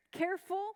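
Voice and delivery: Careful set apart and stressed. Shown as a demonstration. Teacher flagging a key word to the class, stress on Careful. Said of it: "I really wanna emphasize here".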